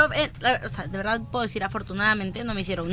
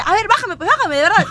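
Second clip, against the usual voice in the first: high, raised mock-angry voice; acted brash challenge, pitched up; defiant dare; 8 semitones higher, 5 dB louder than usual. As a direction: imitate a girl daring them to throw her off. Girl daring passengers, retold quarrel.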